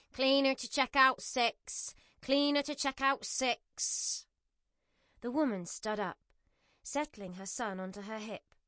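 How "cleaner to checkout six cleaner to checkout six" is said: slow, loud nasal call over a PA; nasal tannoy announcement. Acted. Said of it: "His nasal voice rang out through the tannoy system".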